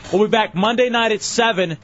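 upbeat show outro read; radio announcer. Acted. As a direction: plug the lineup like a DJ.

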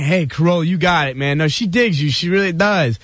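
mock cheerleading. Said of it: "You guys are all encouraging".

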